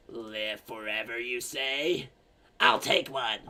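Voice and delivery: put-on low old-lady musing. Old lady tempted by living forever, low old voice building. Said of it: "the old lady's like".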